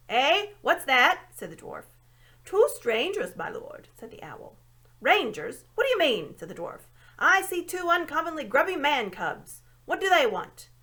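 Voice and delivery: put-on loud, high, gruff old dwarf who mishears; deaf dwarf. A narrator's reading.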